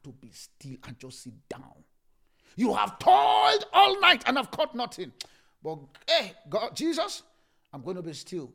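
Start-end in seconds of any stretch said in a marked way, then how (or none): 2.5-7.2 shout. Acted. Preacher cries out to God.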